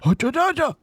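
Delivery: high, loud sing-song exclaim; excited. Real, not acted. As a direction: burst out in delight.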